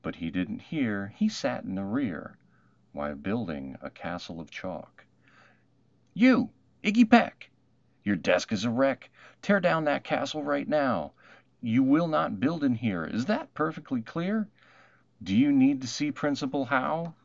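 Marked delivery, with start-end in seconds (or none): 6.1-17.1 loud, high furious teacher yelling at a pupil; a strict teacher barking orders at a boy; angry teacher scolding. Acted. Teacher scolding Iggy in class.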